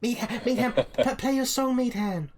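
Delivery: put-on children begging the bard to play; excited kid. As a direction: pester him like a giddy little kid. Kids begging for song.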